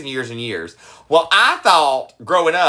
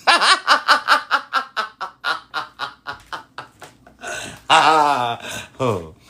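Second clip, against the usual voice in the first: put-on much lower, drawling backwoods good old boy; деревенский мужлан; 11 semitones lower than usual. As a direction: put on a very deep country-boy voice.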